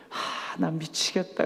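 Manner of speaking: soft, slow, worn-out groan; weary sigh. Retold or imitated. Exhausted consul quietly complains to the pastor, soft and weary. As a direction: let it out like you're at the end of your rope.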